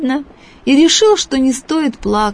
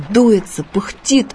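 usual speaking voice

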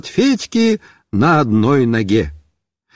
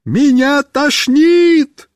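shouting; 8 semitones higher, much slower than usual. Acted. Shouted reply from moving car.

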